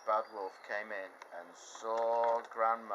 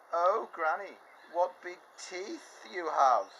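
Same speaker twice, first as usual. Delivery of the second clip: put-on louder, slow amazed girl; wondering girl; 8 semitones higher, 6 dB louder, slower than usual. Girl wondering at grandma's ears, amazed. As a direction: marvel at each feature.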